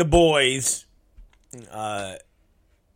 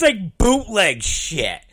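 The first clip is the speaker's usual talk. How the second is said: ordinary talk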